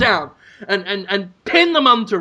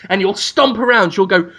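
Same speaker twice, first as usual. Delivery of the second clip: ordinary delivery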